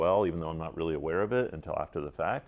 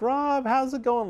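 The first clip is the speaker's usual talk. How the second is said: bright, much higher, cheery phone hello; joyful greeting; 5 dB louder than usual. Retold or imitated. Carol picking up the phone, joyful and high-pitched hello. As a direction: greet him like an old friend, full of joy. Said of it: "such a, like, you know, joyful person".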